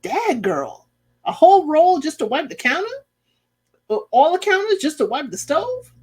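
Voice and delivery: can't believe the waste; incredulous. Pretend. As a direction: pretend reaction to Hannah wiping counters, incredulous. Incredulous reaction to paper towels.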